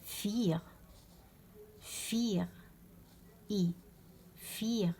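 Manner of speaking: فير starting with f, vowel held as ee; long i. Shown as a demonstration. Teacher models German "four", فير with long i. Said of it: "يتنطق اي كيما".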